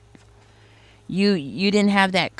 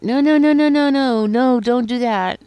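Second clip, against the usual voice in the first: high, loud, frantic string of no's, slowing on the last words; panicked protest; 6 semitones higher, 6 dB louder than usual. Retold or imitated. Overhearing person pleading with people not to invest, panicked. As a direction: beg them to stop, voice climbing in alarm.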